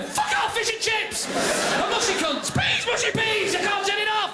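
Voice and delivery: high, yelped compulsive outburst; mock Tourette's-like shouting; uncontrollable word outbursts. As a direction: yelp the words out helplessly, high-pitched. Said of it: "predictive talking that people can't turn off".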